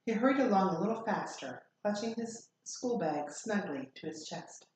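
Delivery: usual speaking voice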